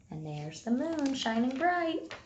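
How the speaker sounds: usual speaking voice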